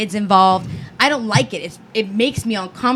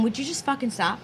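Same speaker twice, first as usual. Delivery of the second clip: much softer, mock exasperated aside; retold hushed plea; 9 dB quieter than usual. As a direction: drop the volume to shush a partner. Retold plea to loud partner.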